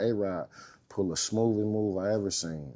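ordinary talk

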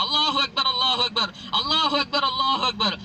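high, loud calling of Allahu akbar; shouted takbir. Acted. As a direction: cry out the takbir from afar. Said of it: "பயந்து கத்துறாங்க அங்கிருந்து தக்பீர்".

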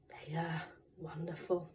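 soft whispered praise; whispering. Real, not acted. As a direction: whisper it reverently.

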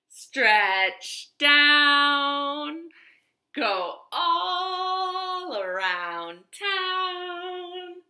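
put-on sing-song, coaxing the children to wiggle; playful stretch-along. Teacher leading children in a stretch, sing-song. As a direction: lead the wiggles like a kindergarten teacher.

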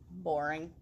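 low, flat "Boring"; dull dismissal. Acted. Potato dismissing the girl, low and dull. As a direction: brush it off. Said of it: "potato dismisses".

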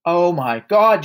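loud, slow, high cry of horror; yelled. A narrator's reading. Father staring into the mirror, yelled. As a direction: yell it slowly, aghast. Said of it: "yelled the father".

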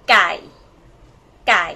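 ไก่ as one syllable on low tone. Shown as a demonstration. Teacher modelling the word for chicken, ไก่ in low tone. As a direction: blend the sounds into ไก่, pitch kept low. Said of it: "This is for low tone".

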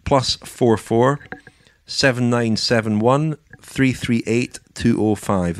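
slow, spaced digits for listeners to note down; slow dictation. Shown as a demonstration. Host gives listeners the number to call, slowly dictated. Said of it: "dictates the number to call".

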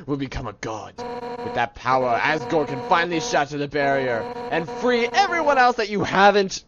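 dramatic villainous declaiming, swelling then menacing; improvised character voice. Acted. Warrior demanding the human's soul, dramatic declaiming. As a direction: deliver a grand threatening speech. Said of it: "I have no idea what accent to give her".

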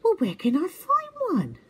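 high, puzzled and innocent; confused little owl voice. A narrator's reading. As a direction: stammer it in wonder. Twit eager.

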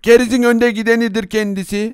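ordinary talk

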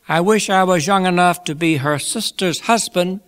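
high, loud after-dinner voice; genial Pickwick, wishful. A narrator's reading. Pickwick wishes he were younger, high genial oratory. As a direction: proclaim the fond wish to the room. Pickwick continues his speech.

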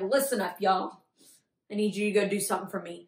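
a hint of a commanding Jesus; lightly voiced Jesus. Teacher voices Jesus calling his disciples together, lightly commanding.

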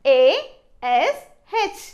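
very slow, letter by letter; spelling out. Shown as a demonstration. Teacher spelling a word for learners, slowly letter by letter. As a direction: spell it with long gaps between letters. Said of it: "ఏమర్థమవుతుంది".

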